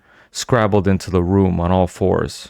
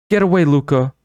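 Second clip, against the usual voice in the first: higher, loud command; stern raised command; sharp order; 8 semitones higher, 4 dB louder than usual. A narrator's reading. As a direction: order the creature away firmly. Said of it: "Ivana ordered".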